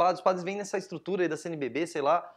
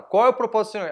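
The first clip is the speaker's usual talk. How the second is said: raised, higher voice; retold insistent question; 5 semitones higher, 6 dB louder, faster than usual. Challenging the louder man without a microphone, retold raised voice. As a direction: push the question loudly over someone. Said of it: "a voz dele já é mais alta que a minha".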